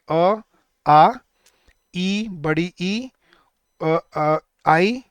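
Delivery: "अ, आ, ई, आई" each held long as a pure vowel; drawn-out Hindi vowels. Shown as a demonstration. Teacher listing Hindi vowel sounds for students, "अ, आ, ई" held long. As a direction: say "अ, आ, ई, आई" one by one, drawn out. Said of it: "वो होती हैं आपकी vowel sounds".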